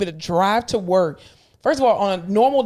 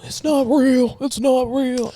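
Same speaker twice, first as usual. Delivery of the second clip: mocking covid denier; 5 semitones higher, much slower than usual. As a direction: sneer it like a denier.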